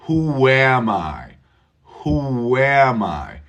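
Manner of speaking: who am I with a slight w linking who into am; linking intrusion. Shown as a demonstration. Teacher showing the linking w to the class. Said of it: "Can you hear the W?".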